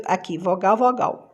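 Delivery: normal talking voice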